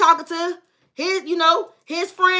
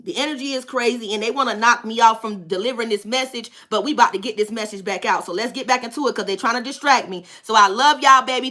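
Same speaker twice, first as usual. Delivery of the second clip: ordinary delivery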